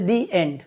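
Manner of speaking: "the" pronounced "dee" before "end". Shown as a demonstration. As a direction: say "the" with a long ee. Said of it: "দি উচ্চারণ করা হচ্ছে". ("the"-কে "দি" উচ্চারণ)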